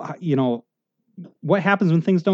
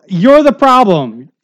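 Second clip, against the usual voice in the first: higher and louder, slow campaign pitch; a hammed-up politician slogan, pitched up and loud; mock slogan; 6 semitones higher, 8 dB louder, slower than usual. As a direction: announce it like a ridiculous campaign ad.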